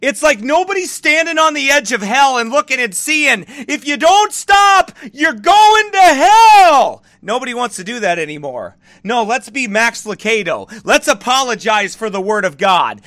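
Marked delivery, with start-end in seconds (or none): 3.7-7.0 slow, loud, higher shout; shouted warning. Real, not acted. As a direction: hammer the warning home slowly. Warning sinners from hell's edge.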